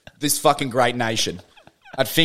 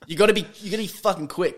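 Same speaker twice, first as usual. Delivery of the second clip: normal talking voice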